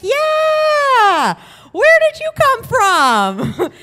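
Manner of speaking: loud, high, stretched-out mock enthusiasm; fake friendliness. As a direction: overdo the cheer to cover panic. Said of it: "real friendly".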